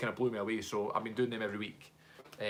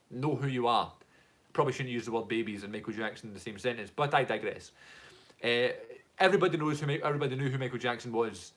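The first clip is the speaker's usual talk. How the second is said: normal talking voice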